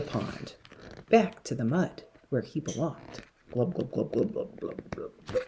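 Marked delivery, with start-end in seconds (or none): 3.5-5.4 soft glubs fading into a whisper; fading bubbles. A narrator's reading. Big Blub sinking into the mud, glubs fading to whisper. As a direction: let the bubbles sink away to nothing.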